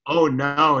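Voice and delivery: loud, high-pitched feigned panic; mock alarm. Kid winding up his mom in the car, mock alarm. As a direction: cry out in exaggerated alarm.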